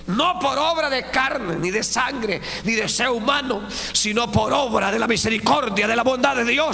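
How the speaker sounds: high, loud fervent preaching; full-force preaching. Acted. Preacher exhorting the brethren, high and loud. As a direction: drive the point home.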